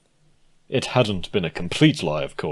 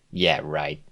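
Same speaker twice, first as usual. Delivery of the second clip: scoffing; 3 semitones lower than usual. Acted. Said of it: "Lyra scoffed".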